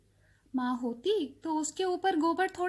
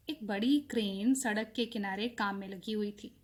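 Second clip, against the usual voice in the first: plain reading voice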